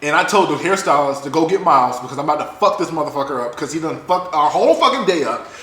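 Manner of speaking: normal talking voice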